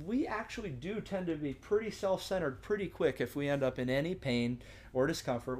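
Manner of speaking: ordinary talk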